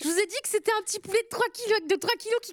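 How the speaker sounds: high, quick, delighted chatter; thrilled, voice jumping up; excited. Real, not acted. Receiving favourite dinosaur figurine.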